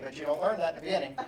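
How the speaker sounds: ordinary delivery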